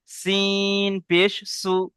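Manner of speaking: letter names long drawn out and level; slow, measured drill for pupils to copy; demonstrated spelling recitation. Shown as a demonstration. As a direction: chant each letter very slowly, then join the syllables. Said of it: "একটা পড়ে দেখিয়ে দিচ্ছি".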